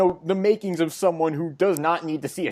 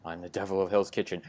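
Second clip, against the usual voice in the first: much lower, soft growl; gruff Daredevil voice; 9 semitones lower, 11 dB quieter, faster than usual. Retold or imitated. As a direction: drop into a low hushed growl. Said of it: "All he does is".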